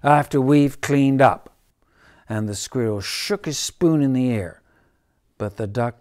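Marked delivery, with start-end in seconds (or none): 0.0-1.5 loud angry finish; stormy cat. Acted. The cat yells after the duck leaving. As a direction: still storming, loud and sharp. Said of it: "stormed the cat".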